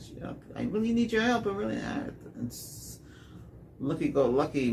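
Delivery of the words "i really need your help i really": high pleading plea; mock desperate woman asking for help; quoting her plea. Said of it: "she said".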